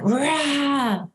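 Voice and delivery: sudden high shouted roar; mock angry roar. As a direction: let out a frustrated roar at the kid.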